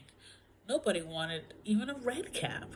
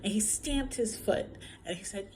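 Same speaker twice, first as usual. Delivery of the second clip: plain reading voice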